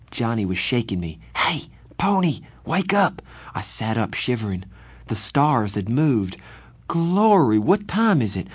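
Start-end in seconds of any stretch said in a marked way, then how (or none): 1.3-3.2 high urgent wake-up call. Acted. Johnny shaking him awake. 6.9-8.5 startled. Acted. Startled on waking.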